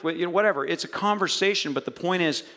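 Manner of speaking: normal talking voice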